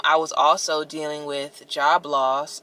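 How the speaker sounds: ordinary delivery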